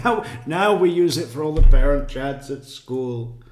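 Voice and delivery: mocking her breezy tone through a laugh; a laughing, loud parody of a chirpy witness; laughing mock sing-song. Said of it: "this fucking incessant sing-song voice".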